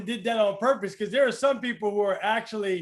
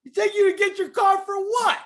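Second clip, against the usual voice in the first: incredulous outburst; 9 semitones higher, faster than usual. Real, not acted. Listener reacting to the story, incredulous and quick. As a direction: blurt it out, can't believe what he's hearing.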